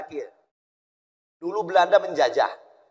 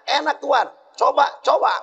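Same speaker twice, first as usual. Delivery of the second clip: eager villager; 10 semitones higher than usual. Retold or imitated. Villager offering coconut.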